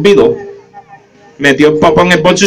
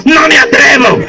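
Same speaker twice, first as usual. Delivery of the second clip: put-on feeble old man; 9 semitones higher, slower than usual. Sick old man voiced.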